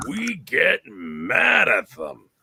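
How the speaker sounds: slow, high, loud mock rage; mock angry. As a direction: drag out the anger, hammed up. Mock rage about Canadians.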